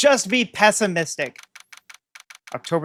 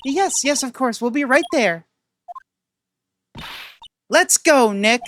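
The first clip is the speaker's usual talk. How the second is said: eager high voice; 5 semitones higher than usual. Acted.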